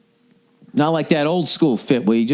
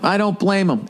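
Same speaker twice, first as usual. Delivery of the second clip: ordinary talk, nothing marked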